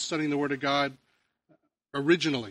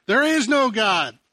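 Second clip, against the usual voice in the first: loud, higher, slow taunting yell; defiant shout; 7 semitones higher, 7 dB louder, slower than usual. Acted. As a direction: fling it over the fence at her. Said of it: "he'd shout out so that she would hear".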